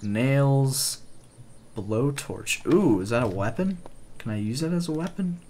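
ordinary talk, nothing marked

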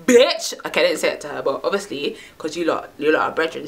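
usual speaking voice

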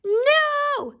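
very high, drawn-out squeal of refusal; high piggy yelp; crying out. A narrator's reading. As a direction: cry it out like frightened little pigs. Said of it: "cried the pigs".

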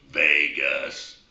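a low, slow little frog calling out the club; talking frog. Acted. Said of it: "He goes".

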